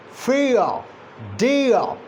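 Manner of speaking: "f, a" and "d, a" as "fee-uh", "dee-uh"; gliding diphthong demonstration. Shown as a demonstration. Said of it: "Gliding movement from one vocalic position".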